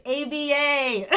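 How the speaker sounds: drawn-out, high, loud punchline; gleeful retort. Retold or imitated. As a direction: stretch each letter out, laughing. Repeated punchline as retold retort.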